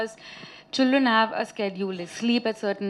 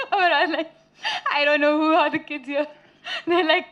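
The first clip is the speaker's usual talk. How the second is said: higher voice broken by laughter; laughing while telling; 5 semitones higher than usual. Real, not acted. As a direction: crack up mid-story. Cracking up while retelling.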